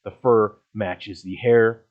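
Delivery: ordinary talk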